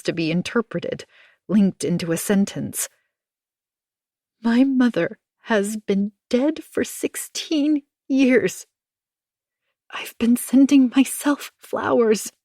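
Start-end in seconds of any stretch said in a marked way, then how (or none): 4.4-12.3 tearful confession. Acted. Tearful confession over the phone.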